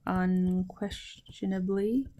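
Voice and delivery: soft, drawn-out syllables; slow dictation. Shown as a demonstration. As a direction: repeat it slowly and softly for learners. Teacher repeats translation slowly for learners.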